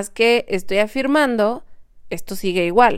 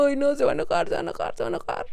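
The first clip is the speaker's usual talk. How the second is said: worried fretting; 5 semitones higher, 3 dB quieter, much faster than usual. Retold or imitated. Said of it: "si estoy maquilando como".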